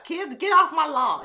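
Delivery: put-on high, loud, slow grumpy old-grandma yell; crotchety grandma. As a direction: shoo the kids off like a crotchety granny. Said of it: "crotchety old grandma".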